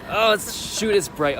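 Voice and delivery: startled yelp at the brightness; surprised. Real, not acted. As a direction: caught off guard, high and loud. Sudden bright light outside.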